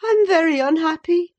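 high, loud complaint; high-pitched, self-pitying outburst; Catherine, crying out. A narrator's reading. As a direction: lament it in a high young lady's voice. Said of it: "she cried at last".

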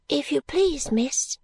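put-on high, timid-sounding child servant; soft-voiced little girl. A narrator's reading. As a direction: voice a small girl politely addressing her mistress. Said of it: "pursued Charley in the same soft voice".

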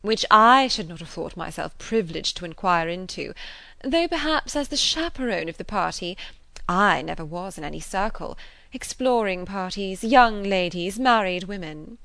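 mildly indignant, punched-out phrases; emphatic. A narrator's reading. Mrs. Elton objecting to the game, emphatic. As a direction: press the point as the party's chaperone. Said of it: "cried Missus Elton emphatically".

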